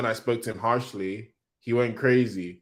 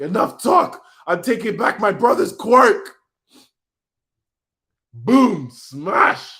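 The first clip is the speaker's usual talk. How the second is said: loud, high mock villain yell; hammed-up villain roar; 8 semitones higher, 8 dB louder, slower than usual. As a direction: bellow like a cartoon villain. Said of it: "said Shigaraki".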